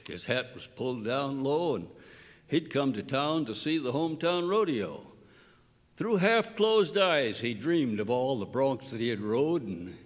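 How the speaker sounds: reciting a cowboy poem with rising pitch; cowboy poetry recitation. Shown as a demonstration. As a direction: perform it like a rodeo ballad, storytelling lilt. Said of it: "let's hear it".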